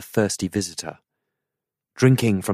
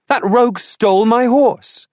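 much higher, louder outraged protest; indignant outburst; 8 dB louder than usual. A narrator's reading. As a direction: let the outrage burst out. Arthur indignant about stolen horse.

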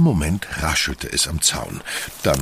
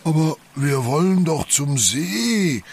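protesting call; 13 semitones higher than usual. A narrator's reading. Yoshi objecting to Doctor Mietz, calling out high. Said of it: "rief Yoshi".

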